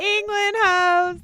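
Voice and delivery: a slow, drawn-out, loud hammed-up British voice; mock British accent. Friends celebrating England, mock British accent.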